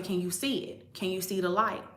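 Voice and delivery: coaxing the brother during the clearing; gentle soft question. Retold or imitated. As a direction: ask him softly if he sees the light.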